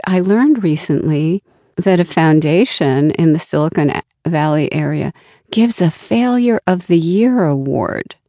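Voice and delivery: normal talking voice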